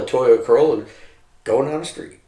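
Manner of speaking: usual speaking voice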